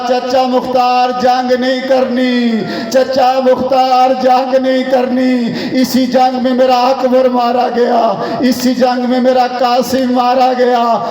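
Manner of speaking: slow, long-drawn plea to an uncle; preacher voicing a captive's grieving entreaty; pleading lament. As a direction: stretch the words into a mournful appeal.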